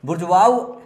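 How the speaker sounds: bur-ZHWAH-oh, बुर्जुआओ sounded out loud; French pronunciation. Shown as a demonstration. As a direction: say बुर्जुआओ as bur-jua-o, clearly. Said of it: "इसका जो है pronunciation है".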